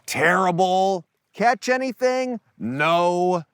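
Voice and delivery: high, drawn-out mock holler; acted irritated shout across water; irritated yell back. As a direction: call back peeved, without real volume. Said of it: "a little bit irritated as he yells back".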